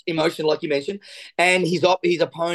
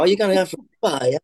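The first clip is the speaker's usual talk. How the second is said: mock-impressed tease; much faster than usual. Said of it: "I go".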